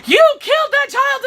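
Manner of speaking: high furious shout; angry shout. Retold or imitated. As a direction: yell it, horrified and angry. Paladin yelling at merchant.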